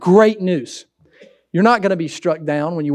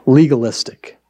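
normal talking voice

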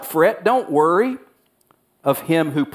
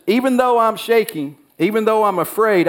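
higher, anxious voice of the prophet; trembling admission to the Lord; fearful confession; 7 semitones higher than usual. Acted. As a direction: voice rising in mild fear. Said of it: "he says".

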